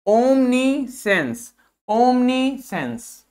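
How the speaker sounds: ओमनीसेंस stretched out slowly and evenly; slow model word. Shown as a demonstration. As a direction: drag out ओमनीसेंस so students copy. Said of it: "इसको देखिए". (ओमनीसेंस को खींचकर धीरे बोलें)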